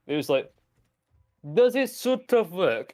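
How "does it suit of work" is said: high, slow mock Russian accent; mock Soviet official. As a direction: play a Soviet designer with a put-on Russian accent. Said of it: "the Soviets were hilarious".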